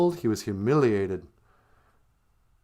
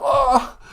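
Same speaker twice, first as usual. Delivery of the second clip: high, loud cry of dismay; pained cry; 10 dB louder than usual. Acted. Beaver pulls out his flattened tail, pained cry. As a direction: cry out sharply, pitched way up.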